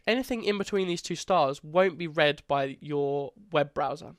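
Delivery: ordinary delivery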